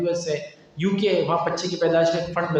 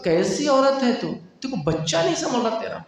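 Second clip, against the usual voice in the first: higher, sharp exasperated scold; 7 semitones higher than usual. Retold or imitated. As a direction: scold her, patience finally gone. Third visit, Umar scolds mother. (جھنجھلا کر ڈانٹنا)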